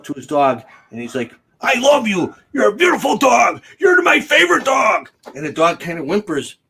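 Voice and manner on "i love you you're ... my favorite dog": put-on high, loud baby talk to a dog; gushing doggy voice, much higher; sweet-talking a dog. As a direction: coo lovingly at the dog. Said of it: "it's all in the tone of your voice".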